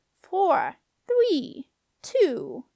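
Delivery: high, drawn-out countdown; squeaky doorkeeper relishing each number; taunting squeak. A narrator's reading. Doorkeeper counting down to the deadline, taunting. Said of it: "came the doorkeeper's taunting squeak".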